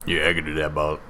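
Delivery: low rambling folksy hobo. Acted. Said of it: "every time that you do the hobo voice".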